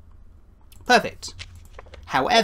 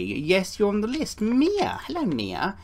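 ordinary delivery

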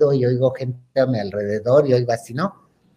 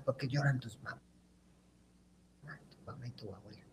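hushed question; 2 semitones lower, 21 dB quieter, faster than usual. Retold or imitated. Asking cousins quietly.

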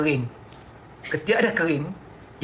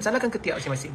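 ordinary talk

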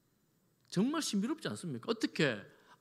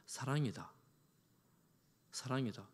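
softly repeated; 4 semitones lower, 8 dB quieter than usual. Shown as a demonstration. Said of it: "이렇게 표현해 봅니다".

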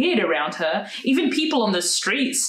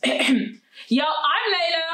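high-pitched, contained yell; mild yell; 7 semitones higher, slower than usual. A narrator's reading. Layla answering her new teacher, mildly yelled. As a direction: call it out too eagerly, raised pitch. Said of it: "she yelled".

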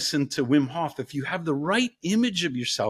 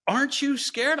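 much higher, teasing warlock question; 10 semitones higher than usual. Retold or imitated. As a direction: toy with the next victim. Warlock questions second daughter.

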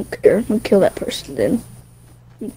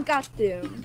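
usual speaking voice

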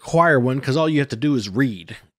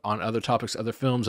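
ordinary delivery

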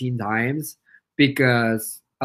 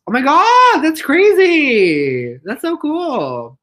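high, loud, drawn-out excitement; amazed; 10 semitones higher, 6 dB louder, much slower than usual. Real, not acted. Hearing viewer's uncle made the props, amazed. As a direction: gush with astonishment, stretching the words.

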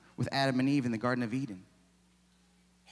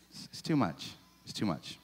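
ordinary talk, nothing marked

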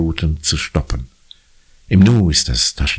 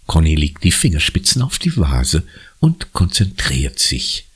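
ordinary delivery, nothing marked

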